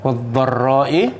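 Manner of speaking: slow, drawn-out Quranic recitation. Acted. Teacher recites the verse to the class, slow and measured. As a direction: recite each word slowly and carefully.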